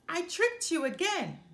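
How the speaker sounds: high, loud gleeful taunt; a boy pleased with his prank, loud; gleeful giggle. A narrator's reading. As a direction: crow over the trick. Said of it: "he said with a giggle".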